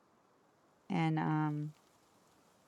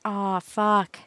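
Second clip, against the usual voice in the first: loud retold exclamation; 9 dB louder, slower than usual. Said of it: "and I was like".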